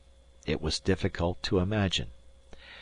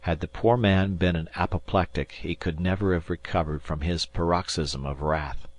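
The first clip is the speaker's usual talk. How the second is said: ordinary delivery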